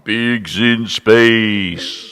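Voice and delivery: slow, very loud echoing announcer; booming announcer. Pretend. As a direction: stretch it like a show announcer. Doing the Muppets announcer.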